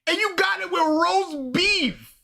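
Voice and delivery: high, slow, indignant complaint; incredulous scolding. Real, not acted. Host berating the reviewer over lamb versus beef, incredulous. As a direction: spell out the outrage point by point.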